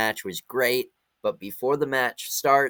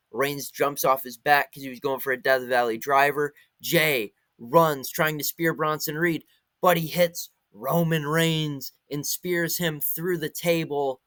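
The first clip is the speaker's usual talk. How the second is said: excited ringside commentary, slowing at the pin; play-by-play call; 3 semitones higher, slower than usual. Acted. Recapping the street fight finish to viewers as a hyped play-by-play call. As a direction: call the finish like a live announcer.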